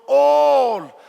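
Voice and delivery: "all" held long and loud; stress on "all". Shown as a demonstration. Preacher reading scripture to the church, heavy stress on "all". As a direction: lean hard on "all", drawing it out loudly. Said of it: "underline the word all".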